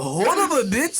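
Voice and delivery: put-on high laughing Japanese accent; hammy Japanese accent slang. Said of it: "but just with a Japanese accent".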